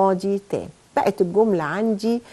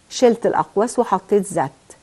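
normal talking voice

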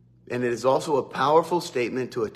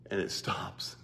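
hushed whisper; 7 semitones lower, 12 dB quieter than usual. Acted. Preacher retelling the storm stilling, in a hushed whisper.

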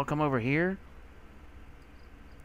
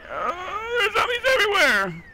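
high, loud panicked cry; frightened yell; terrified shout; 8 dB louder than usual. Real, not acted. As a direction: scream out in fear. Panic at zombie horde.

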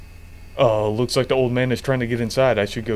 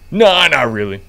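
loud cop voice; 11 dB louder than usual. Pretend. Officer echoes clerk's reply, louder.

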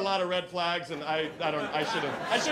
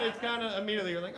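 usual speaking voice

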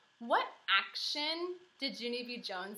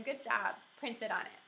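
plain reading voice

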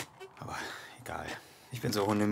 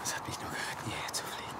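whisper; 4 semitones lower, 7 dB quieter than usual. Acted. Muttering alone in the dark.

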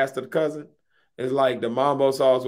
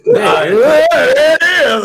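slow, loud, high words through laughter; laughing; 11 semitones higher, 9 dB louder, much slower than usual. Real, not acted. Catching his friend sneaking the topic in, laughing loud. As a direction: crack up while getting the line out.

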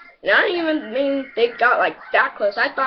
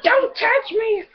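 high, slow, whiny mock-crying screech; hammed-up high sobbing girly voice; mock girl shriek; 11 semitones higher, slower than usual. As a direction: wail it like a hysterical game girl. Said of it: "She's like".

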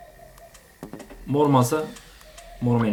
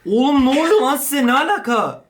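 louder, higher, alarmed outburst; alarmed shout; 10 semitones higher, 4 dB louder than usual. Real, not acted. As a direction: yell in disbelief at the screen. Oyunda panik. (şaşkın ve telaşlı bağırış)

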